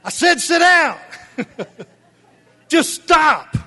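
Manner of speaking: loud high laughing shout; mock shouting God. God ordering his people to sit down, shouted. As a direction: bellow it like an exasperated parent. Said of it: "God's probably like".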